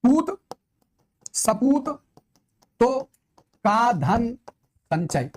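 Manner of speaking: each word set apart with long pauses; drawn-out recital of an example line; slow verse recitation. Shown as a demonstration. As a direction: declaim the proverb slowly for the class. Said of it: "गुरुजी कैसे?".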